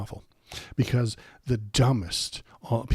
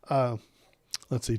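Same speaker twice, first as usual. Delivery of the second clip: ordinary delivery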